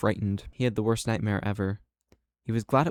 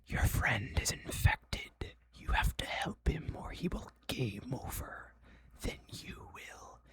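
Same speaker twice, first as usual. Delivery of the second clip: slow, eerie whisper; hushed, drawn-out whisper; whispered game voice; 3 semitones higher, 11 dB quieter, slower than usual. Acted. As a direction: whisper it slowly as an ominous game.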